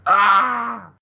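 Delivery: high, sustained "ah" scream; mock scream. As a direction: let out a short imitated scream. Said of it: "there's a scream".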